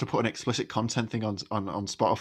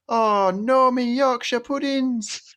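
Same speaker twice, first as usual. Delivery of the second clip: high, slow Geordie wail of dismay; panicked mum in broad Geordie; Geordie accent; 11 semitones higher, much slower than usual. Retold or imitated. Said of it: "laughing at your Geordie accent there".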